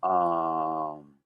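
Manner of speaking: musing aloud; slow thoughtful hmm; thinking hmm. Real, not acted. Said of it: "as he's, he's thinking".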